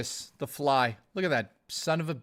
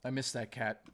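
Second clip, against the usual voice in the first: much softer, real grief over a lost pet; soft, sad aside; real sadness; 3 semitones lower, 10 dB quieter than usual. Real, not acted. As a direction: let it slip out quietly, missing him. His late cat on screen.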